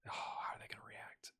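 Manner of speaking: hushed whisper to himself. Real, not acted. Manager whispering to himself over the news, whispered. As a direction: breathe it as a private aside. Whispered aside while reading.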